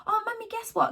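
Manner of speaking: put-on high, eager child's voice; excited young son. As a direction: mimic her little boy bursting with news. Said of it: "he was like".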